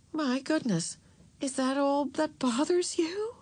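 high-pitched, slow surprise; surprised mother. A narrator's reading. Mother hearing daughter's confession, surprised. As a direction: lift the pitch in mild astonishment. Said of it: "Mother sounded surprised".

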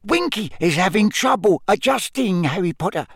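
high elf voice sharing a confidence; confidential squeak. A narrator's reading. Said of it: "squeaked Dobby confidentially".

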